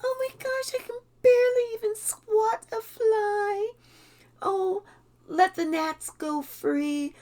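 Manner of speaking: little light voice. Retold or imitated. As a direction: play the harmless sweet old lady, lilting up high. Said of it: "little light voice that sounds like".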